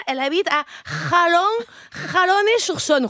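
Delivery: put-on stumbling Spanish-accented French. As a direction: struggle with French place names in a Spanish accent. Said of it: "je me mets à parler comme ça".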